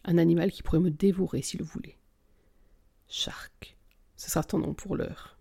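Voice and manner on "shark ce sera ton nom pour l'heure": whispering. A narrator's reading. Intimate naming in a limousine.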